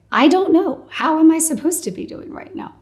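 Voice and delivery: half-laughing disbelief at the question; exasperated. Retold or imitated. Patient answers the doctor's greeting with high exasperation.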